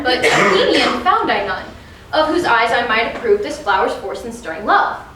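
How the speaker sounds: normal talking voice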